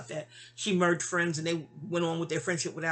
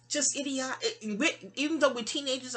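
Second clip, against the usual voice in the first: ordinary talk, nothing marked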